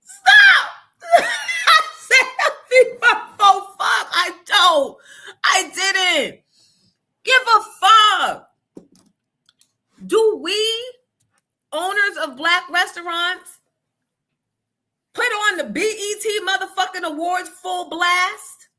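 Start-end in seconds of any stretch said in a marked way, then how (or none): 0.0-4.1 high, loud laugh through the words; cracking up at own slip. Real, not acted. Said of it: "That is funny". 4.1-15.6 loud, high angry insistence; heated. Real, not acted.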